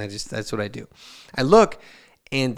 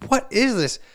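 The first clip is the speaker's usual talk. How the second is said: much higher disbelieving question; shocked dad; 10 semitones higher than usual. Retold or imitated. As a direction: react to a box of cash. Dad shocked at shoebox of cash.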